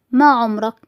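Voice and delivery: usual speaking voice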